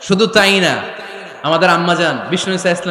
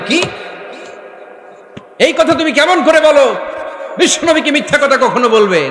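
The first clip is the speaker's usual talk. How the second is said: high-pitched outraged questioning; acted furious father; 7 semitones higher than usual. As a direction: play a father flaring up in anger. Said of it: "রেগে মেগে আগুন". (রেগে আগুন হয়ে ধমক)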